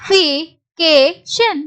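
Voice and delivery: slow, separated syllables. Shown as a demonstration. Teacher repeats the word for learners, syllable by syllable. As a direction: pause between the syllables of "justification". Teacher sounds out the word's ending.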